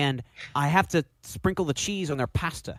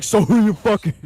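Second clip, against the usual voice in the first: louder, higher mock Brian line; loud nosy friend; 6 semitones higher, 10 dB louder than usual. Friend imitating Brian asking a crude question. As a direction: bark the question like a nosy buddy. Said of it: "Brian be like".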